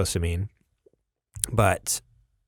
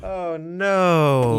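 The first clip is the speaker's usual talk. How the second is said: mock dismay; 8 semitones higher than usual. Real, not acted. Beer spilt in studio.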